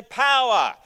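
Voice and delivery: loud, high single word; leading a call-out. Shown as a demonstration. Pastor modelling the word for congregation, loud. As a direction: punch it out for the room. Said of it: "Turn to the person next to you and say".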